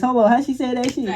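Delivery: ordinary delivery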